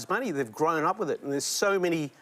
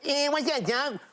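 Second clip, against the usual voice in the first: much higher cheeky cartoon rabbit; Bugs Bunny voice; 8 semitones higher than usual. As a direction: throw them the rabbit's catchphrase. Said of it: "And I'll say".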